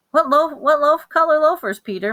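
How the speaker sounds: a chirpy shoe salesman asking; lightly voiced salesman asking a boy; Mister Berman. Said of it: "Mister Berman asked".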